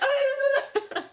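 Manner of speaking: very high, laughing squeal; fangirl squeal. Retold or imitated. Fan admits she has squealed for twenty minutes, laughing shriek. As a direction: shriek with bookish joy. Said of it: "me just being like".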